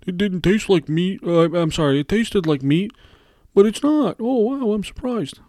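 high, loud hammed-up ad surprise; mock commercial actor. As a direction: parody a cheesy commercial testimonial. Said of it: "the typical commercials".

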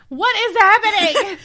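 high laughing disbelief. Real, not acted. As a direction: squeal in giggly confusion.

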